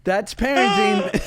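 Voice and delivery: wry comment while crying with laughter; laughing wrap-up. Real, not acted. Mother summing up the story, laughing. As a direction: say it through tears of laughter.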